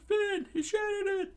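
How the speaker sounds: very high squeaky cartoon voice; crew screaming. Pirate crew shocked at broken fin, high squeal. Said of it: "the crew screamed out".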